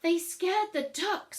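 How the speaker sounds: loud, high, slow indignant lady; complaining neighbour. Acted. Missus Snooks complaining, indignant. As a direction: complain in a huff.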